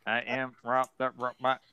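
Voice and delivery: monotone robot voice. Acted. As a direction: recite it evenly like a machine. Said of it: "what has Robbie the Robot sound?".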